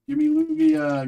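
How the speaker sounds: high, loud plea for Lugia; pleading squeal. Real, not acted. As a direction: beg the cards for it. Begging for the chase card.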